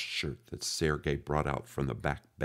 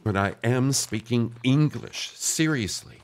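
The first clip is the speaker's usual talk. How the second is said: insistent; 8 semitones higher, 7 dB louder than usual. A narrator's reading. Pete insists, exasperated.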